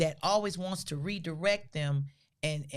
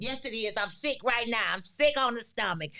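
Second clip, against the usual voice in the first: mother's mock complaint; 5 semitones higher, 3 dB louder than usual. Mother claiming sickness.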